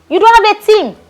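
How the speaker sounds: high, loud mock outrage; mock indignant. Actress scolding the host in high, loud mock anger. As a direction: tear into him, half-joking.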